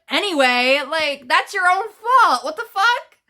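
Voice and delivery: building mock outrage to a squeal; retold indignant rant. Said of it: "I was like".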